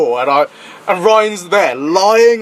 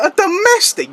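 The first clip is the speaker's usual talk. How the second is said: high-pitched retold disbelief; baffled, much higher query; incredulous; 13 semitones higher than usual. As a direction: echo it back in high, mock disbelief. Retells his disbelief at friend's excuse.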